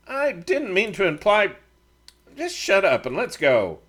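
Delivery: shutting the argument down; Matt, curt. A narrator's reading. Matt cuts argument short.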